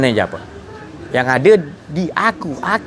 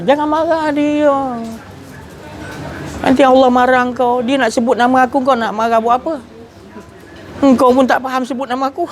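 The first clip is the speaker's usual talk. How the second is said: divine rebuke; 8 semitones higher than usual. Retold or imitated.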